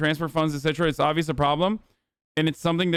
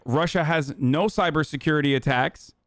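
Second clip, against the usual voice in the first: usual speaking voice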